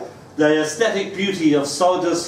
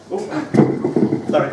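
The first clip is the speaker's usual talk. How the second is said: ordinary talk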